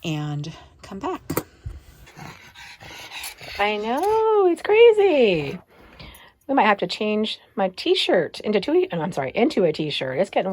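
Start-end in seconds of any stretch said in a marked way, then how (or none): 3.6-5.6 high, drawn-out dog talk; high singsong. Real, not acted. Talking to her dog in a high, drawn-out singsong. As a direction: stretch out crazy up high.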